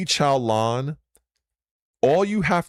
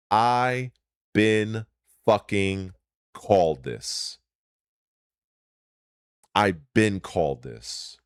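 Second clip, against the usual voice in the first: usual speaking voice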